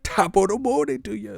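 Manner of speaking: hammed-up cheerful hello; mock cheery greeting. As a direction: chirp it like an eager servant. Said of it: "the slave is".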